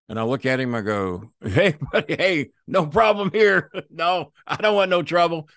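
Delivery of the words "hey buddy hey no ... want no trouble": higher, laughing, nervous backing-off; nervous placating. Retold or imitated. He calms a jealous wrestler, higher with nervous laughs. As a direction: soothe an angry big man, hands up.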